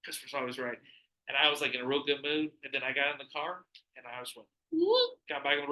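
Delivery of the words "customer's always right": low, soft concession; subdued, low-pitched answer; retold grudging reply. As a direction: say it down low and quiet. Retold resigned reply from employee.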